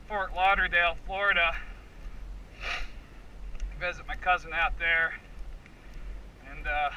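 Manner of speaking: usual speaking voice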